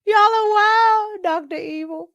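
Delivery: high, teary laughing exclamation; laughing through words. Real, not acted. Vlogger laughing at chat comments, high teary laughter. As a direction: crack up mid-sentence.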